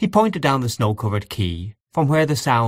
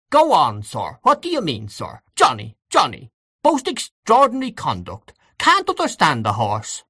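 put-on high, blustering old gentleman; pompous indignation; 6 semitones higher than usual. Gabriel imitating the old gentleman scolding his horse, indignant. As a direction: exclaim in pompous outrage, pitch leaping up. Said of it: "was highly indignant".

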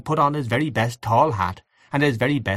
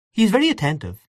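high-pitched arch remark; 10 semitones higher, faster than usual. A narrator's reading. Aunt Kate on an over-attentive guest, arch. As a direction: say it high and pointedly. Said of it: "she said archly".

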